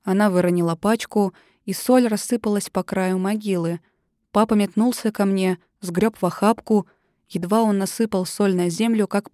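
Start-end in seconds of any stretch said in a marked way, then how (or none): none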